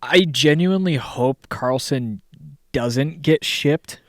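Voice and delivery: usual speaking voice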